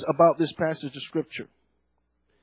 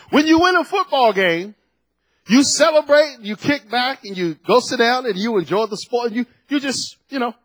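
higher, louder preacher's cry; fervent sermon climb; preaching at full force; 6 semitones higher, 4 dB louder than usual. Acted. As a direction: rouse the congregation, voice lifted high. Preaching on winning elections.

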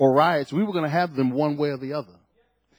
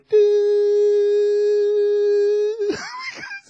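laughing shout. Real, not acted. Laughing outburst in sermon.

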